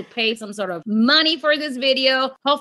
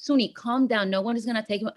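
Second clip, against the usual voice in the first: a hint of a husband's soft reassurance; calming husband; 3 semitones lower, 7 dB quieter, much faster than usual. Husband soothing his panicking wife, softly reassuring. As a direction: ease her down, softer, no alarm.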